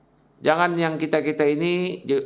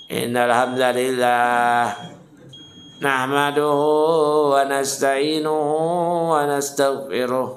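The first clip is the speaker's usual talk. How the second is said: slow, drawn-out droning sermon opening; mock sleep-inducing Arabic recitation; monotone khutbah; slower than usual. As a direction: drag out each phrase like a dull preacher. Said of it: "Nanti kita khutbah monoton".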